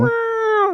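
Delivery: high drawn-out "wow" wail; imitated creature cry. Showing how the limping cat-like man sounds, high imitated wail. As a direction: imitate the cat-man's high, stretched howl. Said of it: "That's how he sounds".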